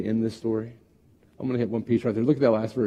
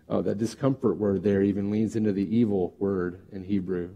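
ordinary delivery

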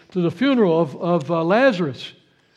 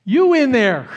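loud, raised self-address; calling to oneself; 4 semitones higher, 5 dB louder than usual. Acted. Psalmist talking to himself, loud mock call. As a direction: call inward as if rousing someone. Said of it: "this is self-talk".

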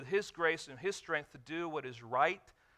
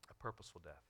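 much softer, lower repeat of purposeful death; hushed echo; 10 semitones lower, 15 dB quieter, much faster than usual. Acted. Preacher echoing his own line, hushed. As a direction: let it fall to a near hush. Repeats phrase softly for effect.

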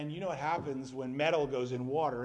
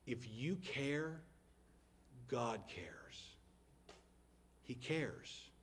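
tender reassurance; 10 dB quieter, much slower than usual. Real, not acted. A pastor comforting the congregation, softly and slowly. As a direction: reassure them quietly.

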